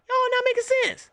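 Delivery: high-pitched disbelief at the logic; exasperated. Real, not acted. Exasperated rant at team logic.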